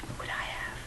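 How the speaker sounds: unvoiced whisper to herself. Real, not acted. Vlogger whispering while picking snacks. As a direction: breathe the words without voice.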